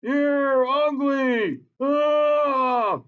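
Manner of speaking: high, slow taunting yell; mock insult. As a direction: hurl it like a playground insult. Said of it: "warm them up by insulting them".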